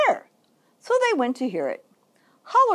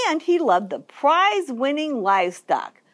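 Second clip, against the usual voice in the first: ordinary delivery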